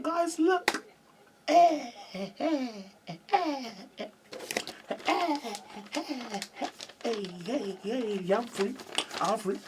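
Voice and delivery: high-pitched gleeful cheering; acted jubilation in a high voice; celebrating. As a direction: chant it like a little victory dance. Acted celebration at youth club.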